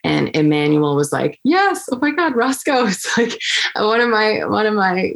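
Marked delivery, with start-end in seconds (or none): 1.4-5.1 high, gushing imitation of a thrilled colleague; retold burst of delight, pitched way up; excited fan. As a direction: gush like a starstruck fan, with a laugh. Quoting colleague's delighted reaction.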